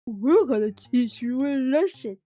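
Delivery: a lightly voiced little kid; a hint of a menacing child; kid voice try. Shown as a demonstration. Trying out a kid's voice on the streamer, lightly voiced. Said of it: "how do kids sound like?".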